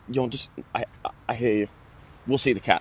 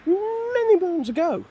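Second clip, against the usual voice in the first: much higher, stretched-out voice; high drawn-out exaggeration; slower than usual. Joking to viewers about school long ago, high and drawn-out. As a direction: draw it out slowly, way up high. Mock old-timer exaggeration about time passed.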